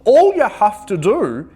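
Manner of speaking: big friendly moose offers trumpet; hammed-up moose voice. A narrator's reading. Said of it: "he said".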